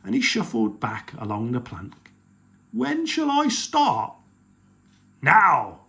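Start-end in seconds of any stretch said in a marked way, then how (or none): 2.7-4.1 troll voice. Acted. Eager troll. 5.2-5.8 pirate chief voice. Acted. Chief ordering.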